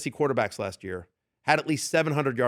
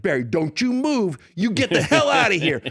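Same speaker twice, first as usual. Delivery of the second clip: higher-pitched, emphatic mock order; 6 semitones higher, 3 dB louder than usual. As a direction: imitate a loud guy throwing someone out. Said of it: "And so they're yelling".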